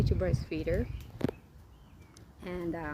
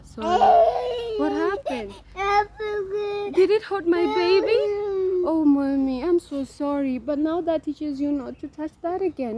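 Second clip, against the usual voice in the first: high, loud motherly cooing; soothing sympathy; 10 semitones higher, 10 dB louder than usual. Real, not acted. Mother comforts child hurt by touching something, soothing sympathy.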